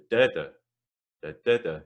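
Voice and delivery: second da punched, others light; stress rhythm. Shown as a demonstration. Tutor chanting the stress of stramaches for students.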